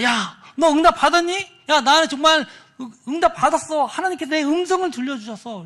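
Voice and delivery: high, loud, eager telling; acted excited news. Youth tells friend God answered him, acted excitement. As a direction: burst out with great news to a friend.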